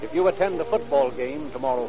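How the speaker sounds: usual speaking voice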